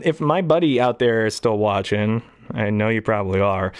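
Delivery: ordinary talk, nothing marked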